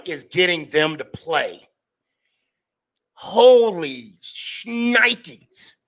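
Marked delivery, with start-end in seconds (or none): 3.1-5.8 slow, drawn-out high amazement; amazed exclamation. Real, not acted. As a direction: stretch it out in disbelief. Amazed at defense.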